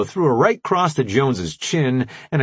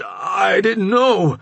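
high, loud, slow plea; shouted plea; 11 semitones higher, 5 dB louder, much slower than usual. A narrator's reading. Jones pleading in the staged fight, shouting high. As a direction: cry out your innocence.

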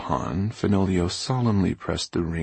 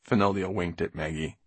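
plain reading voice

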